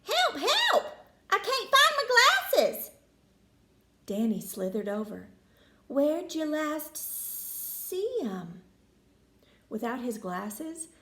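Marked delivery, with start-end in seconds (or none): 0.0-2.9 very high, loud panicked squeal; a little prairie dog in a flap; frantic cry. Acted. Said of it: "The little prairie dog cried". 5.9-8.6 snake voice. Acted. Danny asks prairie dog.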